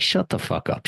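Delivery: hammed-up impatient shaman retort; blunt put-down. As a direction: fire it back like a stern shaman cutting you off. Shaman bluntly shuts speaker down.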